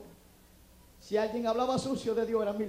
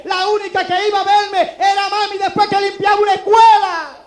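louder and higher, near shouting; fervent preaching; 6 semitones higher, 11 dB louder than usual. Acted. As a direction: hammer each line to the congregation. Predica con toda la fuerza. (predicación fuerte, casi a gritos)

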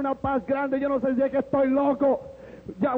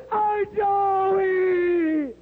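high, drawn-out wailing exclamation; mother's cry; 6 semitones higher than usual. Retold or imitated. Mother reacting to her son's news, crying out. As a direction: cry the name out long and high.

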